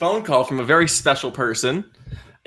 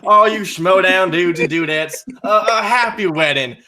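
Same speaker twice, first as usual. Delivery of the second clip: bad Southern accent; 2 semitones higher than usual. Character calling in to wish the couple well, in a bad Southern accent. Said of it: "deeply sorry to the entire South for this accident".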